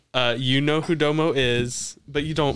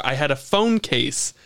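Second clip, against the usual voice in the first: ordinary delivery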